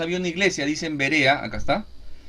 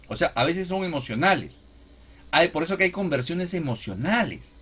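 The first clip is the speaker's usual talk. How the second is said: usual speaking voice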